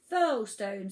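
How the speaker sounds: ordinary delivery, nothing marked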